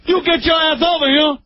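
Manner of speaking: put-on high yelling mother calling child; mom calling kid. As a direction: shout the name like calling home.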